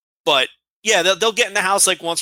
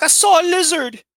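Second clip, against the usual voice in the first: high, louder mock-frightened cry; retold panicked squeal of a scared girl; imitating terrified daughter; 5 dB louder than usual. Father imitates his daughter reporting a lizard, mock terror. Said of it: "screaming at the top of her lungs in sheer terror".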